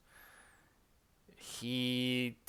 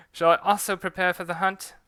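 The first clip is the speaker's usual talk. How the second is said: put-on louder, higher British accent retake; British accent redone with more energy; 7 semitones higher, 9 dB louder than usual. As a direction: stronger British accent take. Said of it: "get used to switching back".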